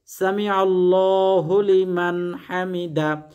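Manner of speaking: each Arabic syllable drawn out very slowly, a measured chant; slow careful recitation. Shown as a demonstration. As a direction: recite the prayer slowly, syllable by syllable, as a model to copy. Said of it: "এই দোয়া পাঠ করে".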